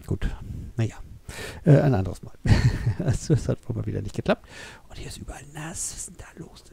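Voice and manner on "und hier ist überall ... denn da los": much softer, whispered puzzlement; a quiet voiceless wondering aside. Real, not acted. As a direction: whisper it to yourself in surprise. Puzzled at a wet floor.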